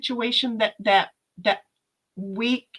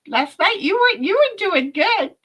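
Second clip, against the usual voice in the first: laughing read of a chat line; 9 semitones higher, 4 dB louder than usual. Retold or imitated. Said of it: "And she's laughing".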